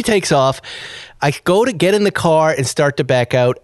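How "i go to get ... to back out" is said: usual speaking voice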